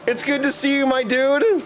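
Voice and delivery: ordinary talk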